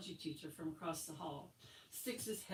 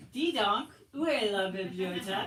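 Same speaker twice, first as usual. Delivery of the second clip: hammed-up French teacher; 2 semitones higher, 8 dB louder than usual. Teacher starting the French dialogue for the class, hammed-up and loud. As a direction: play the teacher leading the class drill. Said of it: "the French dialogue for the day".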